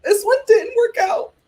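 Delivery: high mock sobbing cry; mock wailing. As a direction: wail it like an overdone fake cry. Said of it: "Natasha starts crying".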